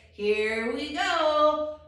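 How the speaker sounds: loud, long drawn-out departure call; train conductor's call. Acted. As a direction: call it out slowly to the whole train. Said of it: "'Cause the conductor says".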